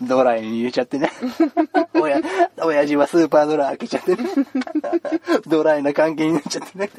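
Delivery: laughing at own dry pun; laughing punchline. Real, not acted. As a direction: crack up at your own joke.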